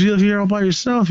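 mock quick question. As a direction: fire it off as the surprised grown-up. Willie asking the kid, voiced.

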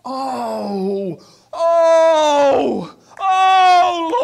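high loud cry of oh, rising. Real, not acted. Preacher overcome, crying out to the Lord. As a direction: let the cry pour out. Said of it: "preacher breaking into worship".

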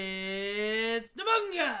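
slow, loud drawn-out reveal; loud, stretched name reveal; excited announcement. Real, not acted. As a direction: call the name out like a ring announcer. Announcing the gorilla's entrance.

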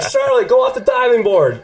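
high, laughing schoolboy call; kids' taunting call. Retold or imitated. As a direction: egg her on, laughing.

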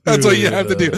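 high, loud put-on voice; true voice. Shown as a demonstration. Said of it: "I'll show you how it's done".

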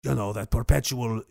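low cartel-guy accent; put-on Latin accent, low and unhurried; Tony Montana. Shown as a demonstration. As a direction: stay in the gangster voice while describing him. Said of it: "That's the voice I was doing".

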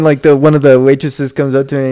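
ordinary talk, nothing marked

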